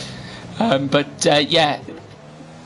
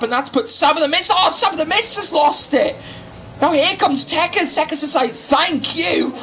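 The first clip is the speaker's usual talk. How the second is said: higher-pitched, excited race commentary; live racing play-by-play; 8 semitones higher than usual. Acted. Commentator calls the battle for second position, excited play-by-play. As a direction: call the overtake as it happens.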